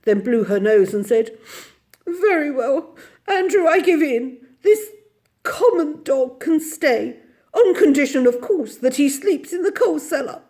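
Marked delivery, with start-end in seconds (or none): 2.0-10.4 high, loud sobbing concession; wounded, quavering lady; tearful surrender. A narrator's reading. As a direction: give in tearfully. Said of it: "sobbed for a moment".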